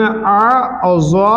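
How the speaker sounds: आ और ज़्वा said slowly, ज़्वा full and thick; heavy ज़्वा demo. Shown as a demonstration. Teacher showing the student how ज़्वा is read full. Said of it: "कैसे पढ़ेंगे?".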